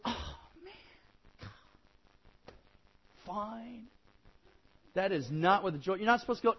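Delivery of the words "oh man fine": a sulky, deflated give-in; grumbling kid. Pretend. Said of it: "never to grumble or complain".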